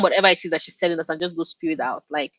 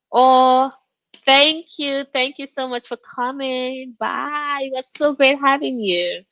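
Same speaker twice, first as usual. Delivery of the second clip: sing-song farewell; 7 semitones higher, slower than usual. Real, not acted. Farewell to departing viewer.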